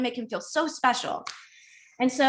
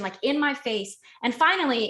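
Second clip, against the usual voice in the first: normal talking voice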